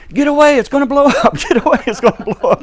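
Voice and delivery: high frantic yell, laughing through it; retold scream. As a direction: shout it like a panicked farmer. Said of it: "he's screaming at me".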